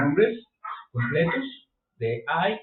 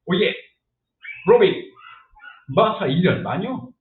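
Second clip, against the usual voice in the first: loud mock Batman; hammed-up superhero call, louder; 4 semitones higher, 6 dB louder than usual. Teacher mocking stiff English as Batman asking Robin. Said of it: "habla como Batman".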